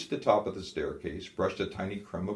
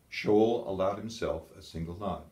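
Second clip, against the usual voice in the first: ordinary delivery, nothing marked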